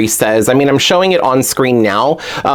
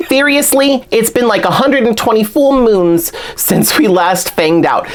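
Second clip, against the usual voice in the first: Claudine; 10 semitones higher than usual. Acted. Doll fan reading a punning diary blurb, Brooklyn accent. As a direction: pitch it up into an amateur Brooklyn character voice. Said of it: "you doing an accent that's not natural for me".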